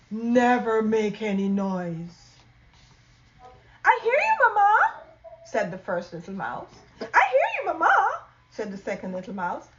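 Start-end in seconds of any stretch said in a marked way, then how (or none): 3.8-5.0 high squeaky little mouse; tiny mouse voice. Acted. First little mouse answering mama in a high little voice. 7.0-8.2 high squeaky little mouse; tiny mouse voice. Acted. First little mouse answering mama in a high little voice.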